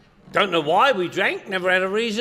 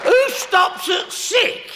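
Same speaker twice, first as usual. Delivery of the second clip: who could stop at sick, shouted high and slow; incredulous shout; 11 semitones higher, much slower than usual. Real, not acted. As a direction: bellow it like the very idea is absurd. Incredulous at sister's excuse.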